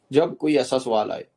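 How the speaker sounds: ordinary talk, nothing marked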